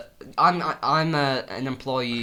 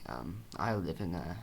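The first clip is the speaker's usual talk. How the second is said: softer, low dramatic telling; mock grim confession; 4 semitones lower, 7 dB quieter than usual. Patient confessing to therapist, soft grim tone. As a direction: tell it like a hushed dark secret. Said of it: "dark confession".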